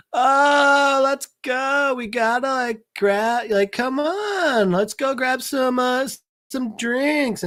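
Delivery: put-on high, drawling drunken whine; drunk guy. Drunk friend urging them to get drinks, high and sloppy. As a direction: beg like a drunk pal, stretching the words. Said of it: "dude's still fucking drunk".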